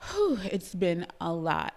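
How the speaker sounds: ordinary talk, nothing marked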